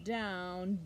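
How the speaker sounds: ordinary delivery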